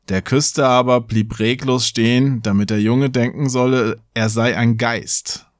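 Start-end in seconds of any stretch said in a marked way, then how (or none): none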